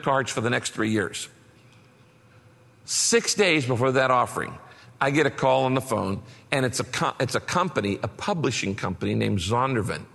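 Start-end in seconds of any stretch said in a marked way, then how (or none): none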